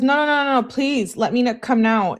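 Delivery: rapid run of high-pitched begging; acted pleading. Girlfriend begging boyfriend to let her come over, acted pleading.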